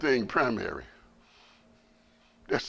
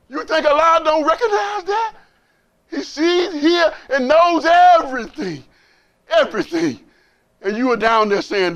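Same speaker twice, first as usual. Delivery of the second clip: full-force preaching; 13 semitones higher, 11 dB louder than usual. Acted. Preacher driving home to the brothers that Allah sees everything. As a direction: preach it out to the whole room.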